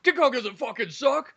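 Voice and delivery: high-pitched indignant protest; hammed-up offended retort; mock outrage. Imagined stranger defending King Kong.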